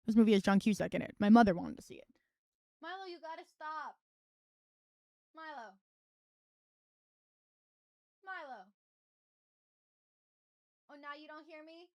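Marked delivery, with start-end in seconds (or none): 2.8-11.9 soft, distant telling-off of the cat; scolding the cat. Real, not acted. Streamer telling off her cat Milo away from the mic, softly.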